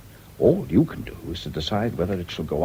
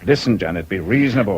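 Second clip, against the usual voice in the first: louder, insistent appeal; firm raised voice; 2 semitones higher, 7 dB louder than usual. Acted. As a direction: raise your voice to get through to her. Doctor calming hysterical patient.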